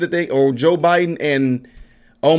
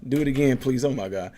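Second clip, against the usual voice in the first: ordinary talk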